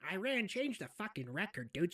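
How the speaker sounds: ordinary delivery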